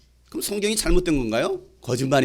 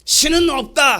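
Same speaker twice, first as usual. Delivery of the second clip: higher-pitched declaration of a philosopher; philosopher's proclamation; 6 semitones higher, slower than usual. Retold or imitated. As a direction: proclaim it high, like a bold thesis. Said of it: "어떤 철학자가". (철학자처럼 높게 선언하듯)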